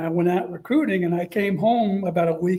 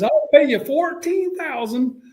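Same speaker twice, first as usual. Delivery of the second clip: higher, slow lowball offer; higher, slower coach voice; Spurrier voice; 8 semitones higher, 3 dB louder than usual. Retold or imitated. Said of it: "he goes".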